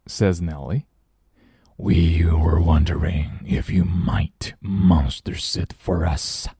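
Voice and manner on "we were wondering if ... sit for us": slow, breathy whispered monster growl; deep scary monster voice. Acted. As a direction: creep it out, slow and hushed like a monster. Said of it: "growls the deep, scary voice".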